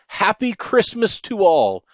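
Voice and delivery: loud, high call ringing out into the night; exclaimed farewell. Acted. Saint Nick calling goodbye to everyone as he drives away, loud and high. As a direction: call it out as the sleigh flies off. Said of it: "I heard him exclaim".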